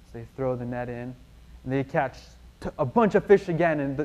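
usual speaking voice

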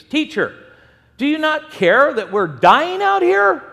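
mock-panicked cry, pitched high and rising; panicked plea. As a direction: plead loudly like a scared disciple. Acts out frightened disciples waking Jesus.

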